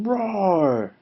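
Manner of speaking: drawn-out word roar, mildly acted; little roar. Flea shows Lion a little roar. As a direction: let "roar" swell like a tiny creature showing off.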